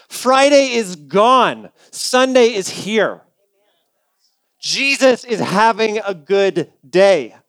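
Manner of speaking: slow, weighty proclamation; slow emphatic preaching. Real, not acted. Preacher proclaiming Easter to the church, slow and emphatic. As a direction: let each phrase land slowly.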